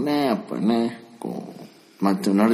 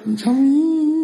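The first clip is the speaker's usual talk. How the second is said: high wailing monster cry; 3 dB louder, much slower than usual. Retold or imitated. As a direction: wail it like a crying monster. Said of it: "ジャミーって泣き方なの？". (怪獣の泣き声)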